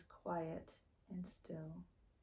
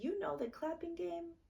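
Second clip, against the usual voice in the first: coaxing; 11 semitones higher than usual. A narrator's reading. Naya coaxing her sister.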